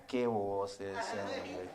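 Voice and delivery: low, soft, very slow mock lament; drawn-out dismay. Landlord despairs of tenant leaving to serve God, drawn-out dismay. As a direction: stretch the "Ah" and "qué" in disbelief.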